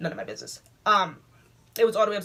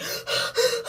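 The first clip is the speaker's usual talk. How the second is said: high breathless gasp of shock; a sharp frightened intake of breath; panicked gasp. Retold or imitated. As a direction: suck in air in a panic. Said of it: "I'm like".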